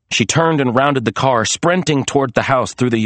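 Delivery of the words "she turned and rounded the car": plain reading voice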